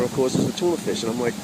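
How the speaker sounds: usual speaking voice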